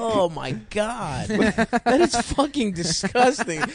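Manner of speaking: slow and high, disgusted. Real, not acted. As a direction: drag it out in disgust. Disgusted by booger eating.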